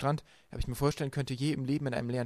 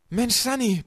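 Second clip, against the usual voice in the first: loud, much higher shout up the shaft; roaring; 11 semitones higher, 9 dB louder, slower than usual. A narrator's reading. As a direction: bellow it up to his sister. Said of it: "brüllte Klaus". (laut hinaufgebrüllt)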